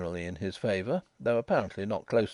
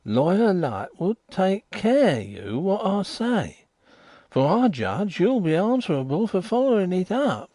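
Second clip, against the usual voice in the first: louder, high warning, slowing on the middle; warning informer; 10 semitones higher, 6 dB louder than usual. Acted. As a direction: warn the lawyer with weight. Riderhood warns the lawyer.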